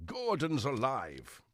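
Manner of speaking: big, slow, booming theatrical shout; Brian Blessed. Pretend. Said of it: "I did Brian Blessed earlier".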